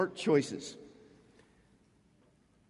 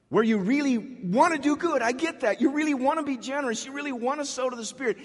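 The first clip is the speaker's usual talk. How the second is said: pitched up, pleading intensity; rising fervor; 8 semitones higher, 4 dB louder than usual. Real, not acted. Preacher pressing the congregation with rising fervor. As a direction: press it home with rising passion.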